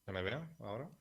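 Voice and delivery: choppy, faint call audio; bad connection. Real, not acted. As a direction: voice dropping out on a laggy call. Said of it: "te has quedado congelado".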